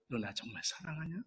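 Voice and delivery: very softly, barely above a breath; Jesus asking Peter very softly; hushed tender question. Acted. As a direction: ask it like a gentle plea. Jesus gently asks Peter.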